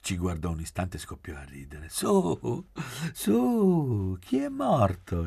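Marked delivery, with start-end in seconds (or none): 1.9-5.2 high, loud, drawn-out rallying cry; lively exclamation. A narrator's reading. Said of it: "esclamò".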